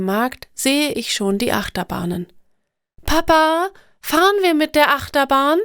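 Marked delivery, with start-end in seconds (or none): 3.0-5.6 put-on higher, brighter child voice asking dad; a kid begging to ride the coaster; eager child's question. A narrator's reading. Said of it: "frage ich". (aufgeregte Kinderfrage, höher)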